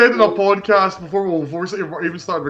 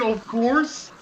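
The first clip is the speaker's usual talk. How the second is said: incredulous echo; 2 semitones higher, 6 dB quieter than usual. Retold or imitated. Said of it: "Actually said".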